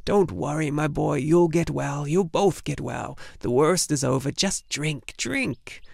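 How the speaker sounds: tiny wrinkled healer coaxing; coaxing old crone. Acted. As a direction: urge the sick boy to drink, gently. Old healer soothes sick Atreyu.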